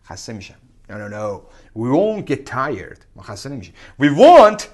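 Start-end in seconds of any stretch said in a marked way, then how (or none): 4.0-4.7 emphatic. Real, not acted. Emphatic declaration.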